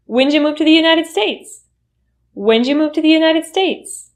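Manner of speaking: When'd you said as whenja, pitch lifted; reduced question. Shown as a demonstration. Question a newcomer may be asked, reduced. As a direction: ask When'd you move to the United States with whenja. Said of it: "The U turns into J".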